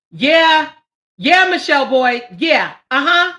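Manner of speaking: high, loud yeahs; excited calling out. Real, not acted. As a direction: hype her up loudly.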